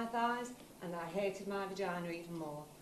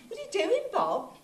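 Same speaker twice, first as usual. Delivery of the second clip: high, loud startled question; startled protest; 13 semitones higher, 7 dB louder than usual. Acted. Woman objects as her lover starts undressing her, startled and high.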